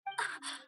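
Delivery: a soft breathy sigh, almost silent; resigned sigh. Acted. Organizer sighing as she realizes she's late. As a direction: let the air out in quiet defeat.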